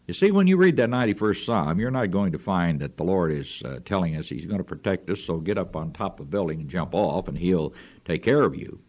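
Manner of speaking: usual speaking voice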